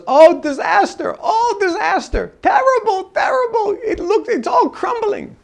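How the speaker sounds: high, loud laughing wail of "disaster"; laughing about disaster. Retold or imitated. Billionaire laughing off his China business troubles, high and amused. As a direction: cheerfully moan about ruin through laughter. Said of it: "and he's laughing".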